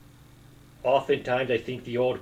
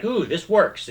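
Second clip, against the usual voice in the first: pleased discovery; 6 semitones higher, 4 dB louder than usual. Retold or imitated. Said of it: "I came up with, like".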